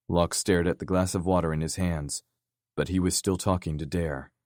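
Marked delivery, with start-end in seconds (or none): none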